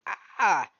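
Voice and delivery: sudden loud mock shriek; mock scream. Imitating being startled by Pepper Ann, loud yelp. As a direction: yelp as if run over from behind. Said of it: "and it's like".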